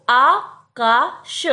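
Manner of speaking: आकाश stretched very slowly, long आ held; slow correct pronunciation. Shown as a demonstration. Teacher drawing out आकाश for the class. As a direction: drag out आकाश with a full long आ. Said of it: "अकाश नहीं".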